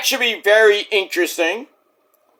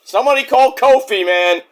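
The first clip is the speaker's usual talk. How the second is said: ordinary talk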